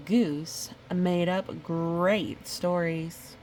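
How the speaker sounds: ordinary delivery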